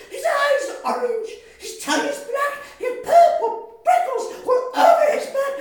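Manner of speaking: fast, very high and loud; panicked mouse. Acted. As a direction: rattle it off in terror. Mouse panics at real monster.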